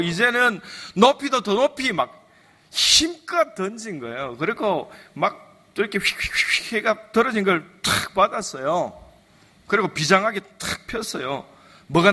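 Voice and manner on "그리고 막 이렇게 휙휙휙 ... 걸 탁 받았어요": ordinary talk, nothing marked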